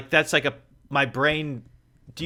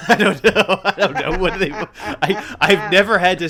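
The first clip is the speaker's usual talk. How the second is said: talking through real laughter; laughing; 6 semitones higher, 8 dB louder than usual. Real, not acted. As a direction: laugh while you explain. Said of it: "laughing through the lines".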